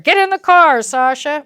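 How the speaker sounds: mock stern order. Parent ordering Sasha into the car, stern. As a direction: bark it like a rushed parent. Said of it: "parent ordering".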